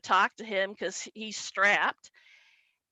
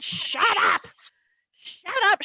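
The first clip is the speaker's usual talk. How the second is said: high-pitched outraged shout of shut up; high outraged dad; 9 semitones higher than usual. Retold or imitated. Dad yelling at the heckler, high and outraged. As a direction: squeal it at him in outrage.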